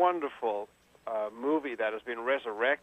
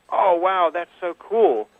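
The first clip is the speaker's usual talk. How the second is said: excited; 5 semitones higher, 8 dB louder, slower than usual. Retold or imitated. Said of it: "he going".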